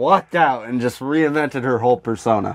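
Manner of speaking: ordinary delivery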